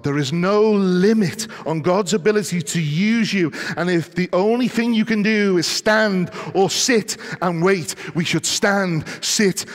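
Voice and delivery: loud, high, slow and emphatic sermon delivery; impassioned preaching. Real, not acted. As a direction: drive it home to the congregation, stretching the key words.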